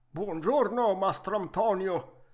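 vecchietto arzillo, voce forte e più alta; Geppetto arzillo. Acted. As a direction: saluta forte, da vecchietto pimpante. (vecchietto arzillo a voce alta)

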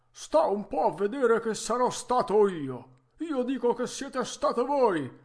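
vecchietto che accusa, acuto e forte; litigio furioso. Acted. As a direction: ribatti urlando, imbestialito. Lite tra i due vecchietti. (furioso, a voce alta)

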